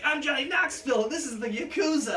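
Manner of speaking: high, loud hammed-up stunt-show intro; mock Johnny Knoxville intro. Hosting a stunt show with the Yakuza, hammed-up announcer voice. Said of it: "good-ass Johnny Knoxville shit of like".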